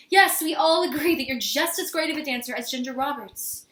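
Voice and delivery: loud, impatient humouring; exasperated placating. Acted. To sister Essie who keeps interrupting, exasperated. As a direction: humour her loudly to get rid of her.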